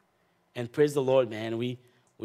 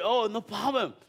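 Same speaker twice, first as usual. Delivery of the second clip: imitating the eager owner, pitched well up; high woman's voice; 11 semitones higher than usual. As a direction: voice the lady high and keen. Woman voiced in retold story.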